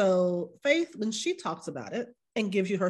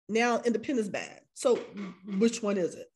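ordinary talk

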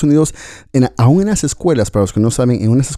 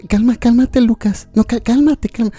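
high soothing coax; coaxing parent; 11 semitones higher, slower than usual. Retold or imitated. Parent coaxing a tantrum child, high and pleading. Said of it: "Y los padres".

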